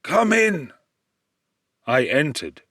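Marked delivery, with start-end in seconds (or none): 0.0-0.7 put-on high old man's voice; lightly voiced old man inviting in; old man's welcome. A narrator's reading. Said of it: "said the old man".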